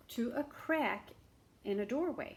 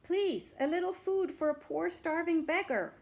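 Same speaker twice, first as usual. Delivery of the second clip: higher, louder begging at the door; pleading beggar; 8 semitones higher, 6 dB louder than usual. Acted. Beggar at a door begging for food, pleading. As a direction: cry out like a starving beggar. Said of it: "he cried".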